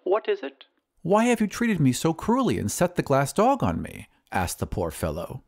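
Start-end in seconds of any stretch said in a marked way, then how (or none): 0.0-0.7 high, sweet voice on the telephone; sweet lady, lilting up on the question; sweet lady's voice. Acted. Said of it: "a sweet voice said". 1.0-4.1 tag. Acted. Jilted suitor complains by phone.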